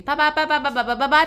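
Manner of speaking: loud, high machine-gun byes; rapid-fire goodbye. Real, not acted. Vlogger signing off to viewers, rapid-fire goodbye. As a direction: rattle off the goodbyes playfully.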